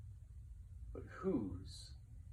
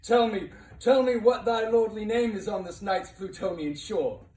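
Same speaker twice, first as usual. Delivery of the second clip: loud and high, pressing for an answer; ringing, insistent plea to the raven; urgent demand; 8 semitones higher, 10 dB louder than usual. Acted. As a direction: demand the bird's name with full force. Narrator demands the raven's name.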